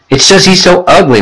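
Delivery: high-pitched child, lightly voiced; a touch of small-boy pleading; protesting little brother. A narrator's reading. Little brother defends himself to mother.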